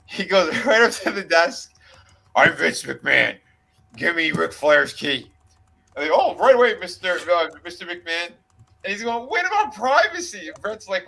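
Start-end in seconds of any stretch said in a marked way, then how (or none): 2.3-5.3 commanding boss voice, a bit lower and louder; imitating McMahon. Retelling, he demands the key in a boss voice. 6.1-8.3 eager clerk. Retold or imitated. Retelling, the desk clerk eagerly serves McMahon. 9.3-10.5 much higher, mock-shocked question; mock indignant. Retelling, a wrestler balks at the privacy breach, mock-shocked. Someone objects at front desk.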